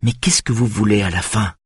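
tight, held-back exasperation; les dents serrées; through clenched teeth. Acted. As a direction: push it through gritted teeth. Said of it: "demande-t-elle les dents serrées".

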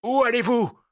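high, loud demand; shouted challenge. Acted. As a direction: cry out to block their way. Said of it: "cria-t-il".

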